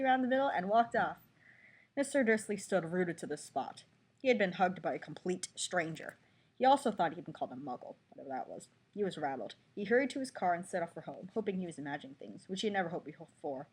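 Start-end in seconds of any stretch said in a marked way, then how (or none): none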